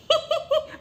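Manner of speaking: very high, jeering giggle; put-on high laugh of a bully; mocking laugh. Bully laughing at someone's colour, high mocking giggle. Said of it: "कहने का तरीका क्या है".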